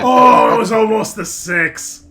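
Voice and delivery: loud, high cry at a near-miss roll; real excited yell; excited outburst. Real, not acted. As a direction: burst out over the dice. Reacting to a dice roll.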